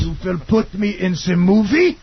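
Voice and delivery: slow commanding mock German; mock German accent. Mocking the German girl's demands, slow mock German accent. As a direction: order it flatly, stiffly.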